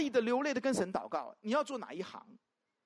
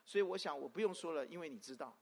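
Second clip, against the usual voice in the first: 1 mock lazy prayer; 4 semitones lower, 7 dB quieter than usual. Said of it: "要不然就是跟神祷告".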